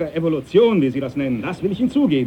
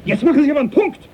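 loud, much higher, cutting him off; captain yelling at Darwin to stop; furious shout; 10 semitones higher, 4 dB louder, much faster than usual. Acted. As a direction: slam the door on the subject. Captain cuts off the argument.

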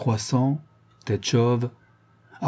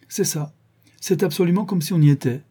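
pleased exclamation; 7 semitones higher than usual. A narrator's reading. Rodolphe praises in dialogue.

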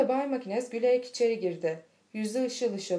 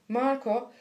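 ordinary delivery, nothing marked

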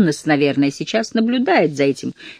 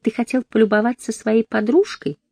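sweet voice; 7 semitones higher, 4 dB louder than usual. Acted. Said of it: "сладким голосом продолжала волчица".

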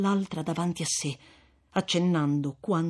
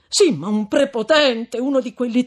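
higher, louder rush; agitated protest; 7 semitones higher, 8 dB louder than usual. Acted. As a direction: blurt out a defense. Renzo si giustifica agitato. (Renzo che protesta agitato)

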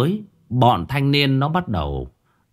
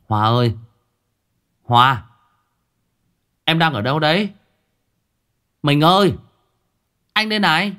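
calling out; 5 semitones higher than usual. Acted. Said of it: "Anh lập tức lao ra".

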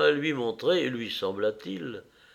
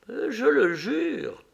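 very high, slow vow; high-pitched, solemn echo; echoed oath; 12 semitones higher, much slower than usual. A narrator's reading. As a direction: swear it back to him, drawn out and high. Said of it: "dirent ses lèvres en écho".

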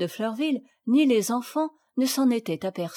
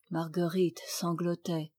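usual speaking voice

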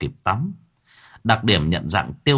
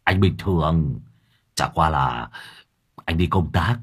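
low-voiced Lâm Thọt; 8 semitones lower than usual. A narrator's reading. Lâm Thọt pleading low with the motorbike driver. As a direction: placate him in a low murmur. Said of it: "thấp giọng".

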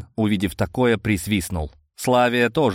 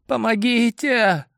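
faint woman's cry; 13 semitones higher, slower than usual. A narrator's reading. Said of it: "раздался едва слышимый голос".

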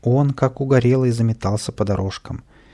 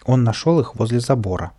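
ordinary delivery

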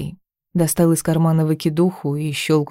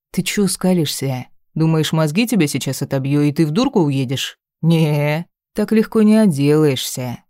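menacing threat. Acted. Thug threatens her, swearing.

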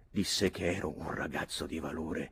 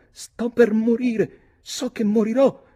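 gasping lament; 11 dB louder than usual. Acted. Nora feigning a swoon, high and wailing. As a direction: moan as if dying on the bench. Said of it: "ansimò Nora".

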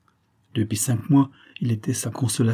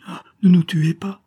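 high, loud plea for mercy; frightened cry; 9 semitones higher, 6 dB louder than usual. A narrator's reading. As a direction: cry out, begging for your life. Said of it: "s'écria-t-elle".

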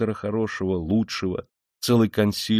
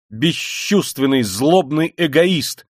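loud, slow, raised accusation; angry cry; 6 semitones higher, 6 dB louder, slower than usual. A narrator's reading. Dunya after her brother leaves, crying out angrily. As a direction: cry each insult out, drawn out and forceful. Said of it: "вскрикнула Дуня".

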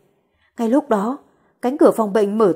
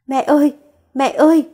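higher, louder tearful cries; crying out; 4 semitones higher, 3 dB louder than usual. Acted. Daughter crying over her stabbed mother, rising cries. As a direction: call for mother through tears. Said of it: "gào thét trong nước mắt".

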